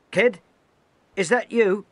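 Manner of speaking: high, loud call across the deck. Acted. Officer calling a new recruit. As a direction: call his name over the noise.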